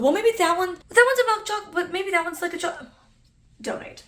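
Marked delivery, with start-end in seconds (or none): 0.0-2.9 high mom voice, dithering. Retold or imitated. Voicing mom, unsure of shade.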